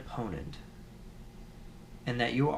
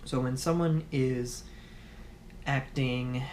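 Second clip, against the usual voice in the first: ordinary talk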